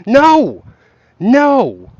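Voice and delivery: high, loud, emphatic no; exasperated refusal. Real, not acted. Streamer refusing to talk price, exasperated shout. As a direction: shout it down, each no on its own.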